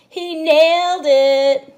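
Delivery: high, loud, slow punchline; joke punchline. Acted. Teacher delivering the joke punchline to the kids, high and loud. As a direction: land the punchline big and slow.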